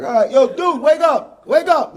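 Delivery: loud, high calls to a sleeping man; shouting to wake him. Retold or imitated. Comic waking a man in a tent, loud shouted calls. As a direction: yell him awake, half laughing.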